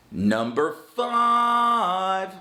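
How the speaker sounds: very slow, loud drawn-out heading; announcing a heading. Shown as a demonstration. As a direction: stretch it out like a title card. Heading over music.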